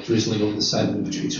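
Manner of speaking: plain reading voice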